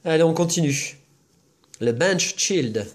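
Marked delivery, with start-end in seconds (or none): 1.8-2.9 slow, hammed-up English accent; mock English accent. Naming a card to a friend in mock English accent. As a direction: drawl the card name in a put-on English accent. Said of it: "Mais quel accent anglais".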